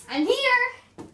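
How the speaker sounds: high, loud eager reply; eager servant. Acted. Plays the servant answering.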